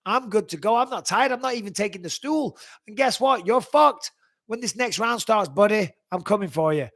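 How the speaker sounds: acted fighter's confident challenge; oozing confidence. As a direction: intimidate the tired opponent with swagger. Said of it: "you're just oozing confidence".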